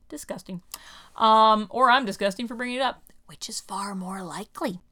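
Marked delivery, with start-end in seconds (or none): 3.3-4.8 soft unvoiced aside; whispered aside. Real, not acted. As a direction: whisper it to yourself. Self-deprecating aside.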